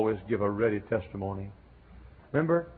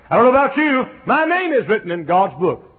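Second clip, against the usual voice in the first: "know" shouted very high and loud, falling at the end; shouted preaching; 12 semitones higher, 11 dB louder than usual. Acted. Preacher cries out his joy at the congregation, shouting. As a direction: shout it at the rafters.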